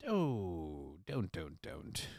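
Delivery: trailing off into silence; pained fading plea. Real, not acted. Disgusted trailing groan.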